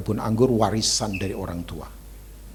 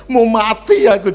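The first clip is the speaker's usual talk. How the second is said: hammed-up despairing cry; 11 semitones higher, 11 dB louder than usual. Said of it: "Simpson itu direngek-rengek Delila".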